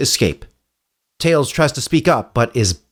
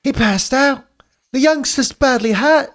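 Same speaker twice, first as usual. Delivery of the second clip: much higher character voice; urgent concern for the kid; worried companion; 12 semitones higher than usual. Acted. Teammate noticing Tails fainted, high and concerned. Character line in script reading.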